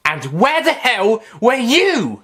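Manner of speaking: cross. Acted. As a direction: demand it crossly. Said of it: "she demanded crossly".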